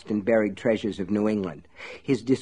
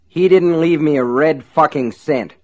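loud furious outburst; furious yell; 4 semitones higher, 6 dB louder than usual. Acted. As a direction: yell it back in fury. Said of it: "Ace yelled back furiously".

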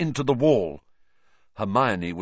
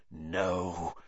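lower, softer, hissed; 5 semitones lower, 9 dB quieter than usual. A narrator's reading. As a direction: hiss it menacingly. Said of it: "he hissed".

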